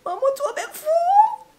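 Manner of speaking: high, loud squealing shout; mock-embarrassed yell; playful shout. Real, not acted. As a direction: shriek it out laughing.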